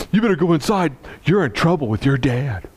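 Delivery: put-on high, gloating kid voice; tattling kid. Kid telling young Kendall he's in trouble, high smug voice. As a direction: sing it like a smug little tattler.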